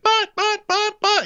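squeaky, flustered buts; high stammer. Retold or imitated. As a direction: sputter high like a caught child. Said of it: "And she's like".

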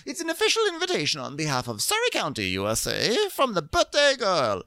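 high, pompous mock announcement; hammed-up high formal decree; imitating Karen's formal invitation. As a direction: proclaim it like an ambassador. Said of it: "she goes".

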